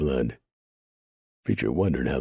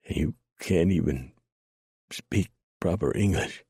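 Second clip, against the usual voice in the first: gasping; 3 semitones higher than usual. A narrator's reading. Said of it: "John gasped".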